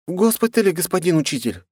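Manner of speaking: put-on high young girl's voice. A narrator's reading. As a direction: answer up high, like a schoolgirl. Girl answers the teacher.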